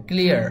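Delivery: ক্লিয়ার said fast as one syllable "klia"; quick correct pronunciation. Shown as a demonstration. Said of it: "এভাবে ক্লিয়ার". (তাড়াতাড়ি একটানে "ক্লিয়ার")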